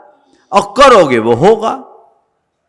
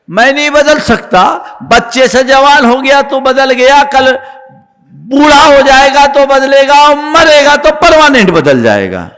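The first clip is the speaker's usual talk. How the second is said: high, insistent sermon delivery; forceful preaching; 9 semitones higher, 5 dB louder than usual. Real, not acted. Preacher rebutting a man who says he cannot change, forceful preaching. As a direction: drive the argument home in rising force.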